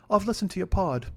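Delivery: high imitated English accent; [name]. Flatmate confessing to the podcaster, put-on English accent. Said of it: "your English accent is shit".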